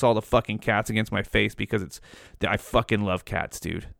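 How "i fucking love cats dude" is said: ordinary talk, nothing marked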